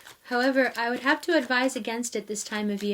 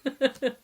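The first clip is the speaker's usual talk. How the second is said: helpless laughter. Real, not acted. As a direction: laugh until it almost sounds like crying. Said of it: "I'm laughing".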